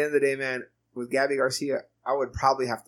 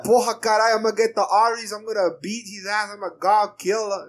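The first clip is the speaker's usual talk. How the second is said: much higher, louder mock Brazilian accent; 8 semitones higher, 3 dB louder than usual. Cyborg vowing to beat Ares, mock Brazilian accent. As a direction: bark it like a Brazilian fighter. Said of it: "Cyborg with that Brazilian accent".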